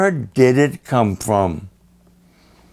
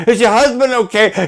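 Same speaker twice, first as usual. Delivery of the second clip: frightened father; 11 semitones higher than usual. Retold or imitated. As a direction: beg her to explain, scared. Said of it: "You're scaring me".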